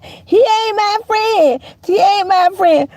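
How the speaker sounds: high, slow sing-song taunt; mocking childish whine. Mocking a grown man who whines like a child, high taunt. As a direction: mimic a sulking kid, drawn out high.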